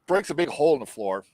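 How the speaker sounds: normal talking voice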